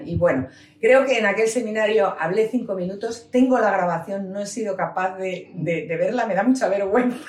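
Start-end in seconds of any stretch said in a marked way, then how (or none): none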